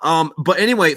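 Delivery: ordinary talk, nothing marked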